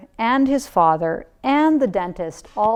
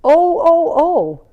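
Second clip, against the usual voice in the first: louder, higher surprised oh-oh; 7 semitones higher, 8 dB louder, slower than usual. A narrator's reading. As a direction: dentist noticing the tooth. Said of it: "and he said".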